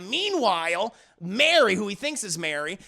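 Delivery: usual speaking voice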